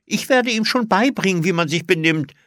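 eager, high, loud call; eifrig. A narrator's reading. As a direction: pipe up eagerly. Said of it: "rief Olli eifrig". (eifrig gerufen)

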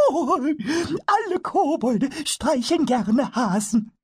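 very high, slow, delighted kobold voice; a tiny goblin gushing about deer; happy kobold. Acted. Pumuckl tells Eder kobolds love deer, high happy voice.

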